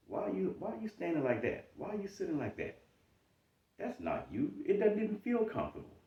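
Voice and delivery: high, scornful questioning; mocking inner critic. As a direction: needle yourself with doubt. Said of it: "Imposter syndrome says".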